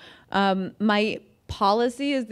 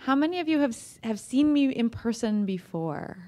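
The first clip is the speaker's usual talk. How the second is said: normal talking voice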